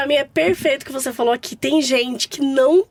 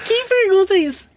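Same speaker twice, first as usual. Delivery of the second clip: high-pitched disbelieving exclamation; incredulous; 8 semitones higher than usual. Real, not acted. Host reacts to mother-in-law's tactless question, incredulous. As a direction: burst out, appalled at the nerve.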